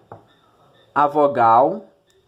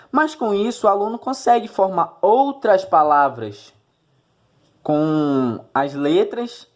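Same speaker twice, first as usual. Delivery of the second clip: ordinary talk, nothing marked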